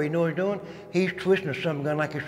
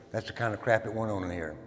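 ordinary talk

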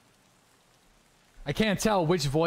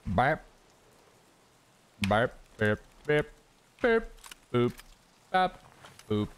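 short spoken burp, boop and bop noises; idle beeping noises; playful blips; 3 semitones lower than usual. Pretend. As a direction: pop out little sound effects one by one. Idle sound effects while sorting.

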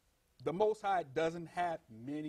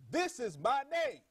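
slow, higher-pitched proclamation; quoted divine pronouncement, raised and deliberate; emphatic declaration; 7 semitones higher than usual. Retold or imitated. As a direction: declare it slowly, with weight on every word. Voices God declaring his name.

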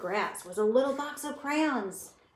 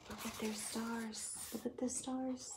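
hushed aside; 14 dB quieter than usual. Real, not acted. Teacher quietly pointing out the picture. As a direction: murmur it to the class.

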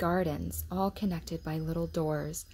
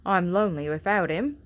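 put-on lonely old gardener; 7 dB louder than usual. A narrator's reading. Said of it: "said the gardener".

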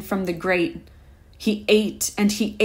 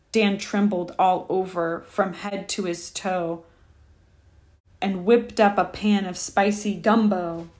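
ordinary delivery, nothing marked

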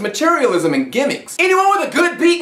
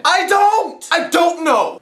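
exasperated shout; 7 semitones higher, much slower than usual. Real, not acted. Ranting about an unclear lyric.

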